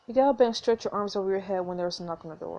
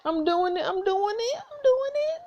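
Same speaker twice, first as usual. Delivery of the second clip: giddy rising squeal; 11 semitones higher, slower than usual. Real, not acted. Player excitedly choosing romance option.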